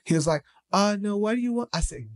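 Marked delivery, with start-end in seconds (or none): 0.7-1.7 higher-voiced imitation of a broken-English accent; imitated foreign accent. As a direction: play an apologetic driver with a foreign accent. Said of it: "forgive me of this accent".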